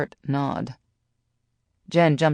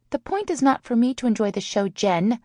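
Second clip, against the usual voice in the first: high, quick snap; exasperated high-voiced retort; snapped; 8 semitones higher than usual. A narrator's reading. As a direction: snap at your friend, pitched up. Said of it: "Sally snapped".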